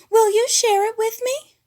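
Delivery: very high, loud sweet ask; high coaxing diva question; Piggy asking. A narrator's reading. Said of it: "Piggy asked Fozzie".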